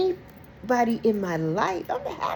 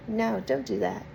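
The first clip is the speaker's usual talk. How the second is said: ordinary delivery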